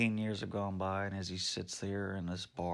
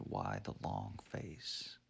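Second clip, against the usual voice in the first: low, slow quote; 11 semitones lower, 6 dB quieter, slower than usual. Retold or imitated. Everyone always asked him this.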